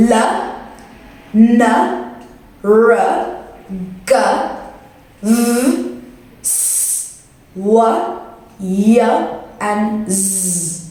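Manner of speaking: "L, N, R, G, V" as sounds, not letter names; isolated throat sounds. Shown as a demonstration. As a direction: voice each sound like "Z" alone, with pauses between. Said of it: "sounds that are made in your throat".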